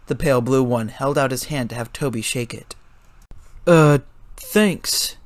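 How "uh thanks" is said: sheepish. A narrator's reading. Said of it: "Toby sheepishly said".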